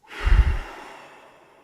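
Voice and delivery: loud exasperated sigh, all breath; heavy sigh. Real, not acted. Streamer fed up with the broken game, loud sigh. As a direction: let the stress out in one big sigh.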